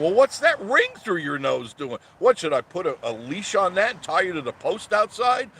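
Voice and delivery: loud, high incredulous questioning; worked-up rhetorical questions; mocking challenge. Real, not acted. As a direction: challenge them with rising indignation. Mocking challenge to nose-ring wearers.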